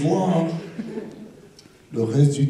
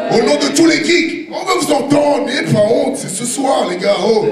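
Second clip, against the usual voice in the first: rallying shout; 10 semitones higher than usual. Acted. Rallying crowd.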